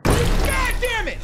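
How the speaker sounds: loud, slow, high frightened yell; drawn-out startled shout; scared horror game streamer. Real, not acted.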